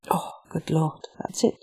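hushed, breathless realisation; whispered realisation. Acted. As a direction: breathe it out as it dawns. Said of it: "realisation whispered to herself".

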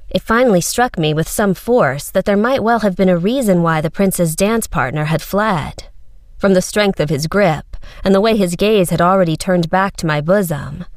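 usual speaking voice

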